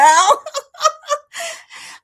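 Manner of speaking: high burst of laughter; loud laugh. Real, not acted. Laughing at cougar joke.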